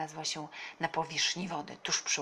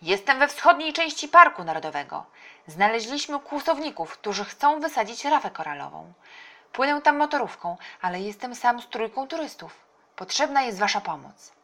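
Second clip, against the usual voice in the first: loud, raised, pressing voice; urgent phone report; 6 semitones higher, 11 dB louder than usual. A narrator's reading. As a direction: report an emergency loudly to police. Uncle urgently phones the police. (głośne, naglące zgłoszenie)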